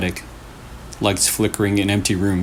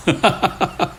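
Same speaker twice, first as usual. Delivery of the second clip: eerie laugh; 7 semitones higher than usual. Acted. Ghost laugh after the whisper.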